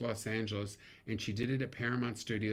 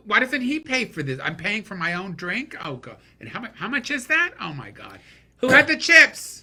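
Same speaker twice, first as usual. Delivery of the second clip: very high, loud indignant woman; shrill outraged woman; 11 semitones higher, 13 dB louder than usual. Retold or imitated. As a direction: squeal about the bill. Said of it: "the women at the table are going".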